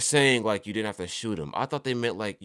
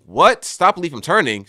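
loud, high-pitched incredulous outburst; stunned disbelief; 6 semitones higher, 11 dB louder than usual. Real, not acted. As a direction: blurt it out in real shock. Stunned by a game statistic.

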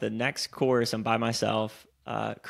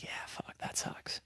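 hushed, breathy whisper, much softer; 15 dB quieter than usual. Retold or imitated. Fellow disc golfer sympathizing quietly, whispered. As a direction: whisper it like a sympathetic aside on the course.

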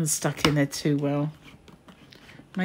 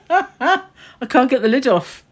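laughing through the words; 7 semitones higher, 8 dB louder, slower than usual. Real, not acted. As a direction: laugh as you say it.

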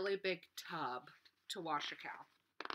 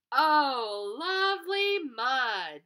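loud and higher, slow happy cry; louder voice; 6 semitones higher, 8 dB louder, much slower than usual. Acted. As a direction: call it out loud like all the happy animals together. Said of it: "it's a louder voice".

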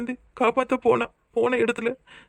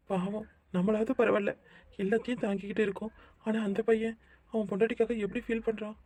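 much softer murmured aside; quiet aside; 10 dB quieter than usual. Acted. Mother pitying her son while he pleads, hushed murmur. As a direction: keep it under your breath.

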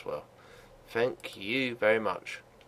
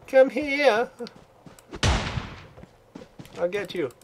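excited yell; 11 semitones higher than usual. Real, not acted. Gamer yelling at the player he chases, high excited shout. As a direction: shout it like you're about to catch him. Chasing enemy in game.

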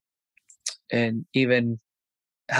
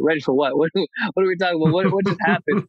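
mock bewildered; 5 semitones higher than usual. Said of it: "I was like".

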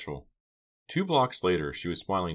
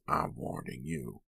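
slow, soft rasp; rasped threat; 9 semitones higher, 5 dB quieter, slower than usual. A narrator's reading. Attacker gripping Pam, rasped threat. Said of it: "he rasped".

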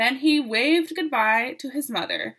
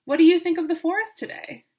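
higher, louder and fast; eager question; 7 semitones higher, 7 dB louder, faster than usual. Acted. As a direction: ask it all in one bright rush. Jack Frost asks eagerly.

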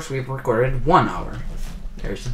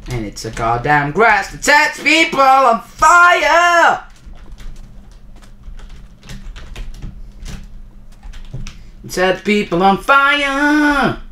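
a slow, loud, high rant that builds to a shriek; outraged yell; 12 semitones higher, 4 dB louder, slower than usual. Real, not acted. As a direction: rage at the game until the voice cracks. Raging at the burning grass.

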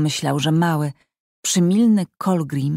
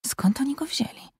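breathy hushed whisper, higher; 8 semitones higher, 8 dB quieter than usual. Acted. Hiding and wondering about the strangers, whispered. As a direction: whisper it so nobody hears.

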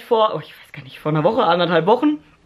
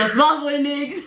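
laughing; 5 semitones higher than usual. Real, not acted. Laughing on the call.

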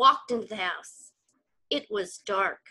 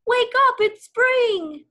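much higher and louder wake-up call; excited shout; 12 semitones higher, 6 dB louder, slower than usual. Acted. Scene: Frog yells Toad awake for spring, loud. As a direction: rouse a sleeping friend at full voice. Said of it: "shouted Frog".

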